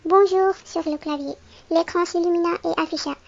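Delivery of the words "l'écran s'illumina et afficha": ordinary delivery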